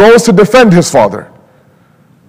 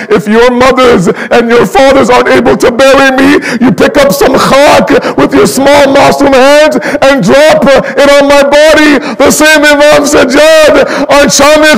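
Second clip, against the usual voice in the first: rising mournful recitation; mournful lament recitation; 7 semitones higher than usual. Acted. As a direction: pull the listeners into grief. Lament builds to climax.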